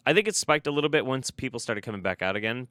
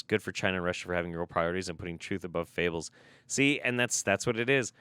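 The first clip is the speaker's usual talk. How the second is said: ordinary talk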